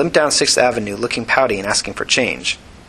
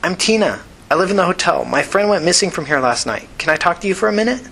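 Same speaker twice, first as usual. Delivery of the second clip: put-on higher, eager young woman's voice; calling through a door; 7 semitones higher than usual. A narrator's reading. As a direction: call out higher, ending on a rising question. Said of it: "Tina called back".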